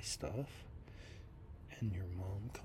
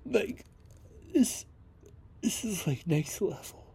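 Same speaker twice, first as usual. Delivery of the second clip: real upset; 8 semitones higher, 8 dB louder than usual. Real, not acted. Still shaken by the fight.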